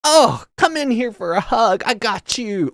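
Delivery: high, slow, doting hype voice; shower her with gushy praise; gushing classmates. Retold or imitated. Classmates hugging the daughter, high and gushing. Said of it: "everyone's like".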